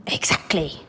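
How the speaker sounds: breathy whisper. Acted. Grandpa Joe confiding in Charlie, whisper. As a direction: whisper it knowingly.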